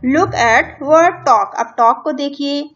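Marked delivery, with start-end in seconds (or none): 0.1-1.5 reading English instructions slowly, word by word; slow textbook reading. Shown as a demonstration. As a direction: read it out deliberately for the class. (किताब से धीरे-धीरे पढ़ना)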